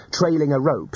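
plain reading voice

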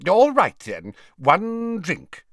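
grudging, high-pitched consent; loud start, slow grudging finish; ungracious. A narrator's reading. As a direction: give in reluctantly, drawing out the last words. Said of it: "he said ungraciously".

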